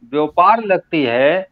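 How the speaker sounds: measured, unsung ghazal recital; spoken verse recitation. Acted. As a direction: recite phrase by phrase with deliberate pauses. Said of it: "मैं तहत में ही पढ़ता हूँ".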